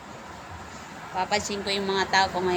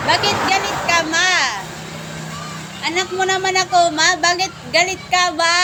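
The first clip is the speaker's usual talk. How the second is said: high, loud playful wail to mama; hammed-up, high-pitched complaint; mock protest; 8 semitones higher, 4 dB louder, faster than usual. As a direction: plead loudly in mock hurt, quickly.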